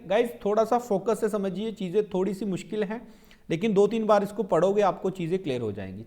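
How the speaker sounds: usual speaking voice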